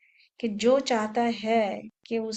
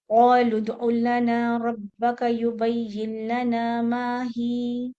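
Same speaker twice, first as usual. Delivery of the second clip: slow, loud Quranic recitation; tajweed recitation; 4 dB louder, much slower than usual. Shown as a demonstration. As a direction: recite the whole verse slowly and clearly. Recites verse.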